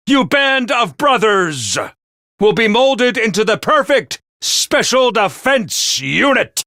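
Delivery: ordinary talk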